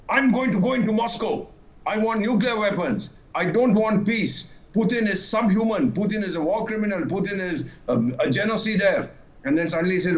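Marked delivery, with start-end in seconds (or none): 0.1-9.1 high, mocking mimicry of a ranting leader; hammed-up sneer at his demands; mocking Zelensky. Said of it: "and this fellow, what does he want?".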